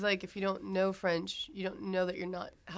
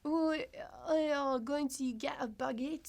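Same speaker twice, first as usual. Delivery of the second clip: cartoonish French accent, higher and slow; badly faked, drawn-out French accent; 6 semitones higher, slower than usual. Shown as a demonstration. Guest attempts the French accent, cartoonish French. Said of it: "can you do a French accent".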